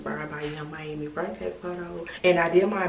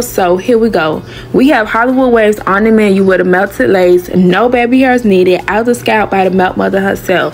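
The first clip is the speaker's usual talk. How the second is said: upbeat, loud voiceover read; vlog voiceover; 5 semitones higher, 19 dB louder than usual. Acted. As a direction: hype narration over music.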